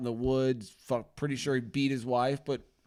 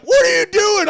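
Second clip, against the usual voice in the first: high, loud frantic yell; hammed-up panicked shout; 12 dB louder than usual. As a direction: scream it in disbelief.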